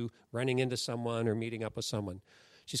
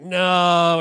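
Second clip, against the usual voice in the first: loud retold "No"; firm refusal; 2 semitones higher, 10 dB louder than usual. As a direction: push the nudge away with a loud "No". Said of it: "He's like".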